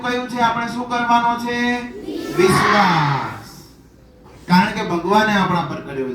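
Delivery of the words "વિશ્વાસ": વિશ્વાસ shouted long and slow, pitch dropping low; drawn-out shout. Real, not acted. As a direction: call out વિશ્વાસ slowly to the class like a rallying word. Teacher calls out the key word.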